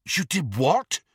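high, incredulous question; shocked disbelief. A narrator's reading. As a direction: ask in disbelief. Harry shocked by confession.

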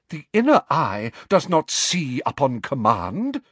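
outraged seer; scandalized. Acted. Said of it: "she said in scandalized tones".

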